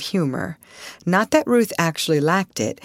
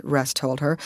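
ordinary delivery, nothing marked

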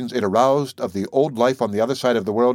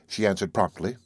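usual speaking voice

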